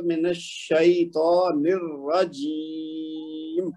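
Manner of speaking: "الشیطان الرجیم" very slow, last ee held long; lengthened recitation demo. Shown as a demonstration. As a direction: stretch every long vowel, holding the end of الرجیم. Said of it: "تو اس کو لمبا کریں گے".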